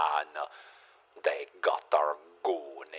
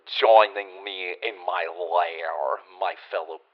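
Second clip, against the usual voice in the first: spooky narrator voice; 6 dB louder than usual. Acted. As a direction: draw it out like a vampire host. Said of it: "your spooky narrator voice?".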